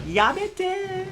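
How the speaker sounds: playful high-pitched "Yamete!"; mock anime cry. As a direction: squeal it like a flustered anime girl.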